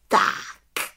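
satisfied little witch call; a contented, drawn-out word before starting; contented exclamation. A narrator's reading. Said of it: "spokojeně zvolala malá čarodějnice". (spokojené zvolání)

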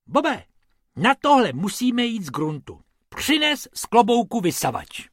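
high, loud bossy rabbit; high rabbit calling for the vacuum; rabbit taking charge. Acted. Rabbit giving orders.

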